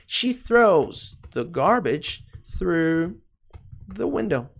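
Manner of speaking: very drawn-out English with pauses; slow model sentence. Shown as a demonstration. Teacher modelling the she form, very slowly. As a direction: stretch each word out for learners.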